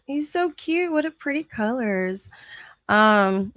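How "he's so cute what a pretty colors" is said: cooing high. Real, not acted. Gushing at a cute dog photo, cooing up high. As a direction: coo it up high over a puppy photo.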